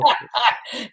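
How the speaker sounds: short high chuckle; laugh. Real, not acted. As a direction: chuckle at a friend's joke. Laughs at host's living-the-dream joke.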